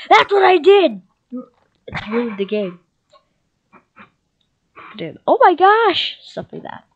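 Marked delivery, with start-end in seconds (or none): 0.1-1.0 high, loud outburst; exclaiming. Real, not acted. Gamer answering about a ruined game, high exclamation. 5.3-6.2 high-pitched outburst; exasperated exclamation. Real, not acted. Gamer reacting to annoying play, high exasperated cry.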